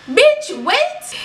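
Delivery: very high-pitched, indignant disbelief; incredulous outburst. Retold or imitated. Storyteller reacting to the girl's unprompted denial, incredulous. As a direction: squeal it in sheer disbelief. Mock reply to accuser's denial.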